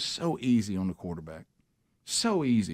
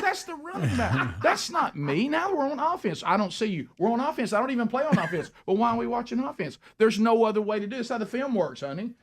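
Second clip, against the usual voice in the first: high-pitched bickering husband; mock exasperation; 11 semitones higher than usual. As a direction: act out exasperated explaining, pitched way up. Acted quarrel with confused wife.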